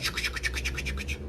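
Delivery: soft unvoiced chuc-chuc chatter; whispered gossip noise. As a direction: mimic whispering behind someone's back.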